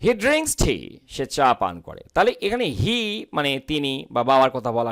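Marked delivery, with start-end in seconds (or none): none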